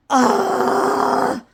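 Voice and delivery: short breathy cry of frustration; sharp frustrated yelp, unvoiced. Real, not acted. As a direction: let out a fed-up yelp. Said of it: "I am so frustrated".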